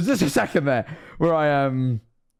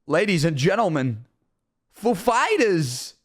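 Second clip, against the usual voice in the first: drawn-out announcer impression, swooping up on "Fighters"; Christopher Walken; slower than usual. Streamer retelling how a host introduced the band, imitated Walken voice. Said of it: "Christopher Walken talks kinda funny".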